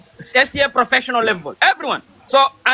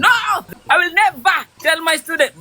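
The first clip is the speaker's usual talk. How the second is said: raised, higher-pitched imitation of a stubborn teacher; mock defiant refusal; 5 semitones higher than usual. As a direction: cry out the refusal in mock protest.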